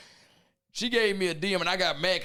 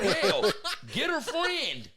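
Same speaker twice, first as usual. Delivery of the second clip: voicing his dad; 9 semitones higher, much slower than usual. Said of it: "He said".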